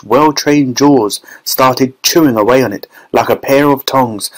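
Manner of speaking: plain reading voice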